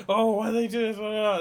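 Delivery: loud, high self-mocking tirade; mock angry rant. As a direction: act out your own petty online outrage. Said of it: "just angrily typing".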